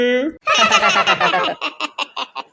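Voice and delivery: low, put-on falling laugh; a deep mocking chuckle. Girl laughs at the bald grandma, low mocking laugh.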